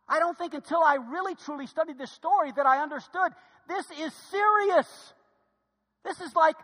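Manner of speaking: preaching at full force. Acted. Pastor urging his church to stop complaining, preaching hard. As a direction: preach it like a revival call.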